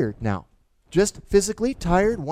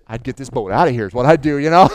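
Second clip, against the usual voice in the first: louder retort; 4 semitones lower, 11 dB louder than usual. Retold or imitated. Greg's answer quoted.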